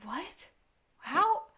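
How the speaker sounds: mild mock bafflement, questions rising high; halting, baffled little questions; gobsmacked. Stunned friend trying to take it in, gobsmacked. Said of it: "Sean is gobsmacked".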